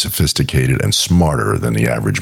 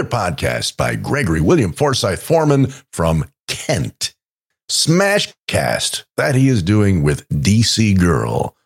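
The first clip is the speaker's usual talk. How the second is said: theatrical ad read; 8 semitones higher, slower than usual. Acted. Co-host cold-reads a listener's podcast plug, theatrical announcer read. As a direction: read it like a grand promo, lingering on key words.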